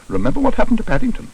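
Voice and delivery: high, quick reminder; pointed, hurried warning. A narrator's reading. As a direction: caution them briskly. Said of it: "warned Missus Bird".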